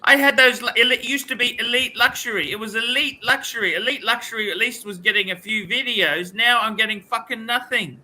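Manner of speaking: high-pitched, aggrieved rant; real exasperated complaint. Real, not acted. Streamer bemoans his failing channel, high and exasperated.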